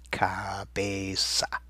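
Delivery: drawn out very slowly and softly; slow soft dictation. Shown as a demonstration. Teacher dictating cabeza slowly and softly for spelling. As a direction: stretch each syllable of Cabeza so they can spell it. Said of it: "Give it a spell".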